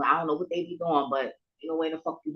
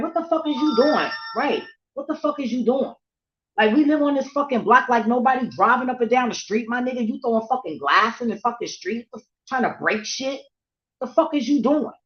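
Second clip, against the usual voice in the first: high, loud outraged rant; retold scream; 7 semitones higher, 6 dB louder than usual. Scolding a kid for throwing glass in the street, high angry yell. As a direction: holler it at full indignation. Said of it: "that's when I scream".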